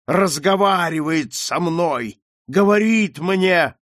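high, slow, haunted cry; crying out in madness. A narrator's reading. Mad marquis seeing a ghost rise, crying out. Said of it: "продолжал кричать маркиз".

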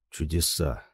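ordinary delivery

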